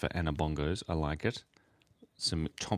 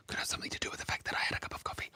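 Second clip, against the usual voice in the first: whisper; 7 dB quieter, much faster than usual. Real, not acted. Confesses the coffee on stream.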